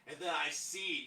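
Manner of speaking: ordinary talk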